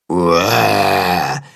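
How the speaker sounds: low, drawn-out mock roar; copied roar. A narrator's reading. Willi copies Tacho's roar, low and drawn out. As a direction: repeat the roar in a low, lumbering voice. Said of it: "wiederholt Willi".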